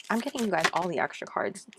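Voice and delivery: usual speaking voice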